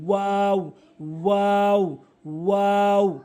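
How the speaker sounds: "ওয়াও" long, from the hollow of the mouth; correct pronunciation. Shown as a demonstration. Teacher models the Arabic letter, long "ওয়াও". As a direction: stretch "ওয়াও" into a held glide. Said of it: "এভাবে উচ্চারণ করবেন".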